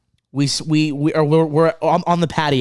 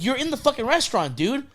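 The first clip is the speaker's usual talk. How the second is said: mock incredulous; 8 semitones higher, 4 dB quieter, faster than usual. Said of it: "Chris is like".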